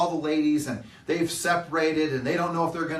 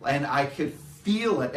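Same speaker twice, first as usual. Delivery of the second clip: ordinary talk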